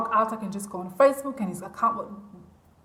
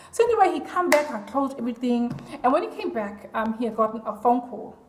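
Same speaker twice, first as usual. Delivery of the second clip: ordinary talk, nothing marked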